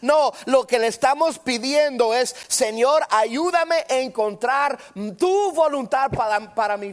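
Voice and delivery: high, loud sermon cadence; fervent preaching. Acted. Preacher teaching the church how to pray, high and loud. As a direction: preach each prayer line with rising force. Preacher contrasting kinds of prayer.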